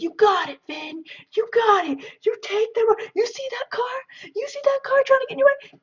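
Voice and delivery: very high-pitched imitation of cheering friends; mock pep-yelling. Friends jumping in to urge on the driver, mock pep-yelling. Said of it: "yelling at him and just doing this inspirational drive".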